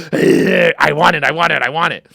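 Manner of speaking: loud, straining greedy outburst; growing frantic with craving, then easing; adrenaline rush. Retold or imitated. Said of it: "this instant adrenaline rush".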